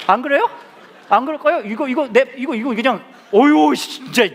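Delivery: high, loud, sputtering indignation; comic fed-up outburst; mock exasperation. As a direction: splutter as a comically outraged parent. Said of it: "너무 화가 나가지고요".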